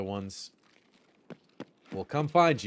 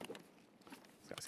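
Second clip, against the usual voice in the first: slow whisper while looting; 23 dB quieter than usual. Real, not acted. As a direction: hush it over the loot. Looting quietly.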